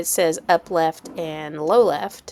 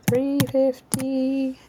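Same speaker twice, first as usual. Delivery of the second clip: sing-song; 6 semitones higher, much slower than usual. Pretend.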